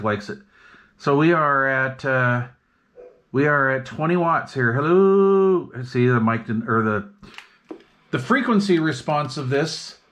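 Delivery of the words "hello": usual speaking voice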